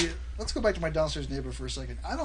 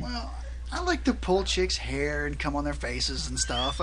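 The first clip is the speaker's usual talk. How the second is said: ordinary delivery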